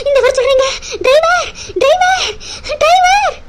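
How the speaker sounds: loud, drawn-out calls for the driver; urgent shout. Acted. Wife calling the driver in an emergency, loud drawn-out shouts. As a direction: bellow for the driver, slowly stretched.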